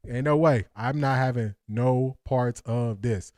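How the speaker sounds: ordinary delivery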